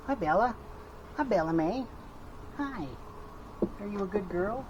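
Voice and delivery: talking to a dog. Real, not acted. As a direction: coo at the dog. Greeting the dog.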